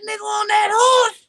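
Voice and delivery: talking through teeth. Pretend. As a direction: call out like an old Southerner. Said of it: "you damn sure sound like you talking through 'em".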